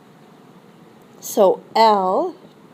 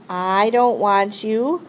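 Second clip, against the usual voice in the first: ordinary talk, nothing marked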